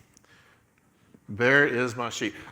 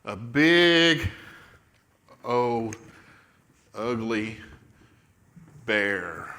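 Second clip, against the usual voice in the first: drawn out with long pauses, sinking lower; word by word, ever lower, building suspense; ominous storytelling; 6 semitones lower, much slower than usual. A narrator's reading. Storyteller announcing the bear's entrance, ominous and halting.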